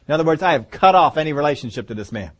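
ordinary talk

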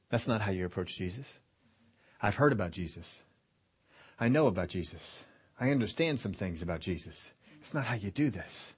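low, soft, understated disapproval; mildly embarrassed. Acted. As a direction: quietly correct your friends, voice dropped. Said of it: "I think he was embarrassed".

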